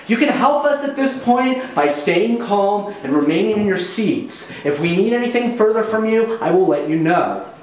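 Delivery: high, brisk sing-song authority; senior flight attendant. Acted. Flight attendant scolding crew and calming passengers, high sing-song. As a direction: keep the cabin in line with a chirpy high voice. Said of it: "sing-song senior flight attendant".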